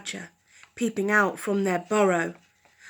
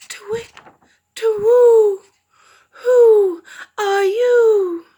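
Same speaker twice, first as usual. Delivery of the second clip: high, loud owl hoot, slow on who; 12 semitones higher, 11 dB louder, slower than usual. Acted. Owl hooting his question to the fox cubs. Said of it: "he said".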